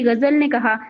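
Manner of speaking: usual speaking voice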